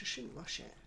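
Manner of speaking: ordinary delivery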